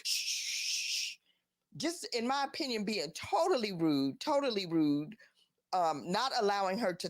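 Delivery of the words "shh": long, drawn-out shush. Retold or imitated. Pastor silencing the woman interrupting his sermon, shushing. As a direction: imitate the pastor's sustained hush. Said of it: "the pastor comes out the pulpit".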